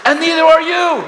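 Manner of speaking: high, loud preaching shout. Real, not acted. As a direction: cry it out to the whole room. Shouted repeat.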